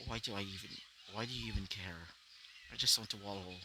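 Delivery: soft, low-pitched, withdrawn reply; a depressed boy quietly pushing his uncle away; mildly dejected. Acted.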